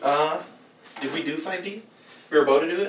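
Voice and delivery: usual speaking voice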